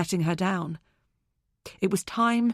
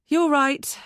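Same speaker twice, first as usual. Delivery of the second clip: higher, louder conceding friend; Zoe's voice; 7 semitones higher, 5 dB louder than usual. A narrator's reading. Zoe conceding to her friend after a silence. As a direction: give in after a pause.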